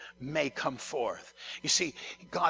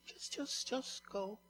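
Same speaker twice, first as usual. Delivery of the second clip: hushed, breathy-soft nudge; the Spirit's gentle hush; gentle whisper; 6 semitones higher, 10 dB quieter than usual. Acted. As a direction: whisper it kindly. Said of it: "the Holy Spirit's so gentle".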